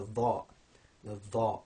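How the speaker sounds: DHA with a heavy voiced th, dark vowel; emphatic dh. Shown as a demonstration. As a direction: press and deepen the DH of DHA. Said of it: "the emphatic version of the dhal".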